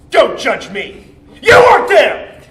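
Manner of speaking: loud, indignant yell climbing high; heated self-justifying shout; defensive outburst. Acted. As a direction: snap back, ending in a high shout. Defending himself to the listener.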